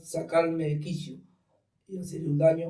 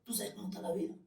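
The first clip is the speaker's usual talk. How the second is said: brother's voice; 5 semitones higher, 13 dB quieter, faster than usual. Retold or imitated. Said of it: "me dice".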